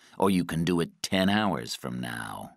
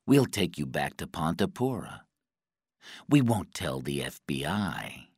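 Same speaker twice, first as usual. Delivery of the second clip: soft, lower-pitched menace; quiet threat; 3 semitones lower, 5 dB quieter than usual. A narrator's reading. Guy offers Patrick life or death, quiet threat. Interrogator threatens a tortured prisoner.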